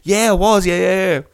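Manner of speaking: high, loud mock-keen yeses; hammed-up eager reply; imitated eager confirmation. Acting out runner's eager answer.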